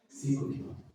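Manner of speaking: breathy whispered repeat; whispering. Real, not acted. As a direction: whisper it for weight. Stresses distance by repetition.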